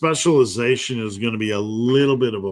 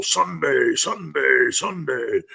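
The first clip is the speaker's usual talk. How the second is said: booming, higher announcer chant; monster truck ad; 4 semitones higher, slower than usual. Retold or imitated. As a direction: hype it like a truck rally ad. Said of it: "like a monster truck commercial".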